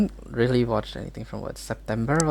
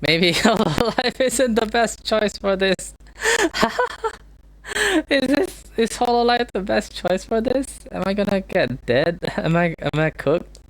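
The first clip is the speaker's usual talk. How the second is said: nervous laughing; 9 semitones higher than usual. Real, not acted. Nervous laughter after scare.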